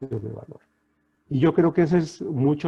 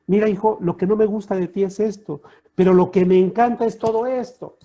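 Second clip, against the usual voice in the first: father to son; 6 semitones higher, 6 dB louder than usual. Retold or imitated. Father addressing his son.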